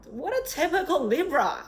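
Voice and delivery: amused exclaim. Real, not acted. Teasing the Libra listener.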